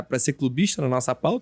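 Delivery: usual speaking voice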